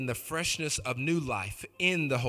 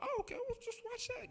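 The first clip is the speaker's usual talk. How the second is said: much higher, mock falsetto; 7 dB quieter than usual. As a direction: squeak it out like a silly inner voice. Retold thought, mock voice.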